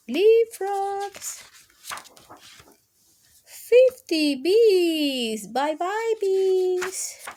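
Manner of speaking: slow, singsong storybook read; slow, high storybook calls; reading to children. Acted. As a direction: draw out each number for toddlers. Counting book read aloud.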